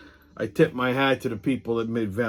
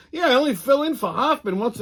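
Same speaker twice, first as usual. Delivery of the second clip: weatherman's modest reply; 10 semitones higher than usual. Retold or imitated. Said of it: "He goes".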